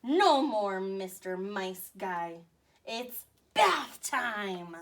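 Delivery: tough-guy line. Acted. Commander's menacing one-liner.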